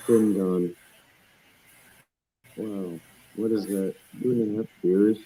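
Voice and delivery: slurred, tipsy speech. Real, not acted. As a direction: slur the words a little, tipsy. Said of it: "Slurring his words".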